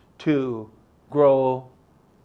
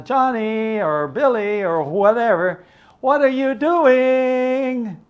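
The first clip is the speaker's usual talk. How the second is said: sing-song mother; 7 semitones higher than usual. Retold or imitated. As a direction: sing it out like a calling mom. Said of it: "always saying in sing-song voice".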